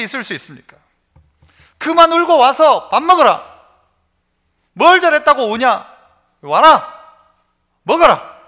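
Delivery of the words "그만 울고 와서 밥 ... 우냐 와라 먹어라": louder and higher, a stern mother calling; scold, then call them to the table; mother's scolding call. Retold or imitated. Mother scolds her child and calls them to eat, loud. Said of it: "저희 어머니를 생각하면서".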